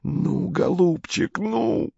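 hushed, drawn-out coaxing, climbing in pitch; slow, strained hiss rising with suspense; hissed urging. A narrator's reading. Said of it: "шипел".

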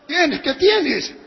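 drawn-out, high, loud acted protest; indignant protest. Preacher shows how he repelled the girl, high indignant protest. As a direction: stretch it out, pitch climbing in outrage. Demonstrating his startled protest.

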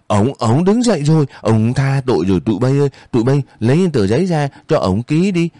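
high-pitched, excited rejoicing; jubilant cheering. A narrator's reading. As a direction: call eagerly to the gang, fussing over the victim. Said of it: "reo hò tở mở".

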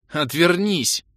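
high, loud female snap; snarled order. A narrator's reading. Spellcaster snarls at the nosy fighter, loudly. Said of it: "рыкнула Кэлен".

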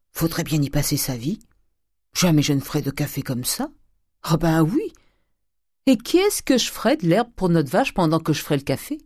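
put-upon maidservant protesting, rising at the end; grumbling servant. Acted. Nanon the servant refusing the new coffee method, grumbling indignantly.

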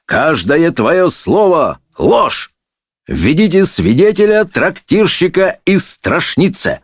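high, slow courtroom proclamation; denounce, then order in the witness; lightly voiced indignant judge; judge, denouncing. A narrator's reading.

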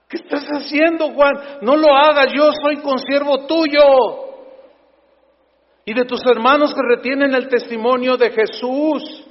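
stern angel. Acted. Angel voiced rebuking John.

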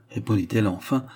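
usual speaking voice

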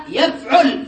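يفعل said yaf-'ul, second vowel u; damma on ain. Shown as a demonstration. Teacher giving present-tense patterns, يفعل as yaf'ul. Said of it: "الضم".

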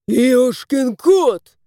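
loud, slow swearing; cursing. A narrator's reading. Husband swears after tripping on the cat. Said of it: "ругался хозяин".

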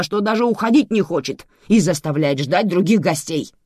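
loud, high exasperated tirade; barked rant. A narrator's reading. Manana tells her sons the history, barking. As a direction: build from firm telling to a barked order. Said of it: "гаркнула Манана".

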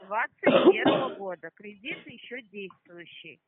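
нарочито медленно, с громким началом; медленно отчеканить каждое слово; медленно и громко. Real, not acted. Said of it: "Вот так слышно". (медленно и громко)

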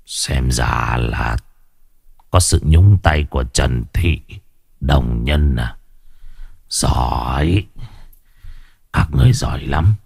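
low, slow menacing mutter under his breath; low bitter muttering old man; old sorcerer muttering. Acted. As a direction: grumble darkly like a spiteful old sorcerer. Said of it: "Lão lẩm bẩm".

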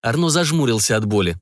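plain reading voice

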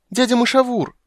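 high, loud joyful shout; girl thrilled to see uncle; delighted child cry. A narrator's reading. Ciri greets druid. (радостный детский крик)

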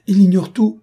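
put-on desperate plea for the boy; pleading outcry. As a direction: cry out in his defence. Governess pleads for nephew.

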